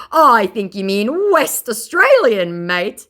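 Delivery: indignant mock scammer. Mocking the scammer's complaint, high whiny mock voice. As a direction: sound put-out and whiny.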